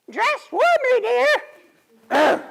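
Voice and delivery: very high, slow falsetto wife voice; imitating sleepy wife. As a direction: mimic her drowsy reply. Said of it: "she will say".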